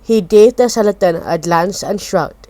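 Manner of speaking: plain reading voice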